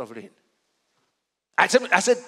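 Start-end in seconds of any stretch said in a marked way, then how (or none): none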